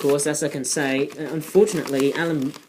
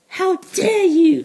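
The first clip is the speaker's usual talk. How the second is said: much higher, slow, indignant mock outrage; Greta Thunberg impression; 13 semitones higher, 5 dB louder, slower than usual. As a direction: quote the activist in a high, scandalized cry. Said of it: "that all infamous saying".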